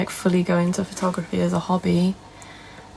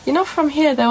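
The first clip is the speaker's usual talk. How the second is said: imitated shopper; 7 semitones higher than usual. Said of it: "She was like".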